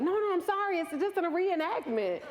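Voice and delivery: high-pitched retold reassurance; mock-flustered explaining; hurried apology. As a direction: plead to calm him down, acted out. Retells apologising to frightened uncle.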